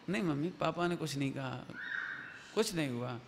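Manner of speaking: low, soft, downcast denial; moody child. Acted. Sleepless child tells mother nothing happened, low and moody. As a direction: brush off a parent quietly, low and subdued.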